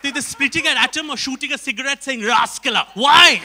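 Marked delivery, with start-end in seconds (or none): 2.2-2.9 punchy, loud film-hero catchphrase; loud hammed-up action-star line; Rajnikant. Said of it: "They either have Ramanujan or Rajnikant". 2.9-3.4 very loud one-word outburst; loud comic protest; exasperated shout. Acted. Mock exasperation at his own bit.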